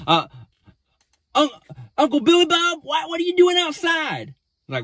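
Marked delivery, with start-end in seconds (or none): 1.4-4.3 very high, squeaky put-on child voice; little girl voice. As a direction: imitate an excited girl greeting her uncle. Voicing revived little girl greeting uncle.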